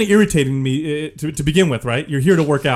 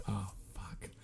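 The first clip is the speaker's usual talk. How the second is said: barely audible whispered curse; whispered dread; 9 semitones lower, 26 dB quieter than usual. Retold or imitated. Hiding manager told she's waiting for him, whispered dread. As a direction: whisper it to yourself as dread sinks in. Retelling his dread when she waits.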